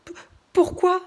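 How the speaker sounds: high, loud stuttering pourquoi; stammering panic. Acted. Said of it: "demanda Jules".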